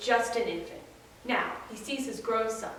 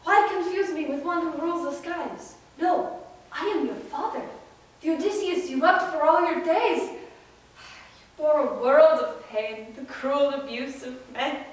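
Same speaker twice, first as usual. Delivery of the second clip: higher, emphatic declaration from a long-lost father; Odysseus, impassioned; 4 semitones higher, 4 dB louder than usual. Acted. Odysseus telling his son he is his father, impassioned.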